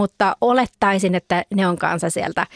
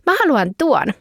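put-on eager child; 8 semitones higher than usual. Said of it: "hän sanoo seuraavassa ruudussa".

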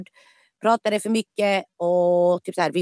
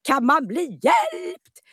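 astonished disbelief; 8 semitones higher, much slower than usual. Retold or imitated. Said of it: "Typ så här".

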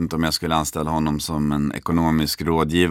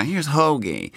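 higher, showman's presentation of a pet; proud introduction; 7 semitones higher, slower than usual. Retold or imitated. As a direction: present the bird like a star. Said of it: "vänder han sig tillbaka mot mig och säger". (högre, stolt presentation som Nick Cage)

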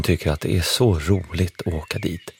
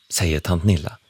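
ordinary delivery

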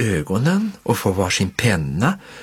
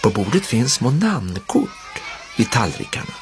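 ordinary delivery

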